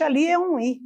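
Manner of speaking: ordinary talk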